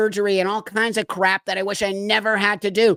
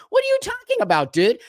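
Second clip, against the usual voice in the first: incredulous; 14 semitones higher, faster than usual. Real, not acted. Reacting to a TikToker's claim, fast high incredulous disbelief. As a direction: pitch shoots way up, fast, in disbelief.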